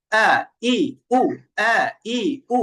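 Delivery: firm glottal onset on "আ, ই, উ", breath held back; crisp stopped-breath attack on each of "আ, ই, উ"; firm hamza. Shown as a demonstration. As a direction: say "আ, ই, উ" hard from the throat, airflow shut. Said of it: "আহ, বাতাস বন্ধ থাকছে।".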